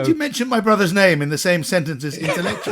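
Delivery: loud, high, laughing disbelief; mock outrage. Brother hears James called an intellectual, laughing mock outrage. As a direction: challenge it loudly as if scandalised.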